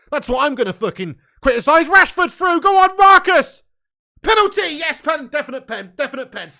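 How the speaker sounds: loud, high yell cheering the attacker on; real fan screaming for a penalty, easing slightly; excited match shout. Real, not acted. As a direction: erupt as the attack breaks, then insist on the penalty. Live attack, appeals for a penalty.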